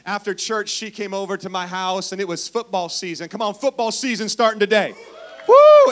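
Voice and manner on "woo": loud high whoop; cheering woo. Real, not acted. Pastor whoops to rally the football crowd, loud cheer. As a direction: whoop like a fan in the stands.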